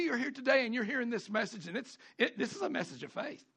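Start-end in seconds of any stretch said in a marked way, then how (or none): none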